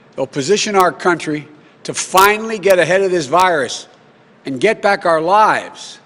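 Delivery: loud, rousing campaign rally speech. Acted.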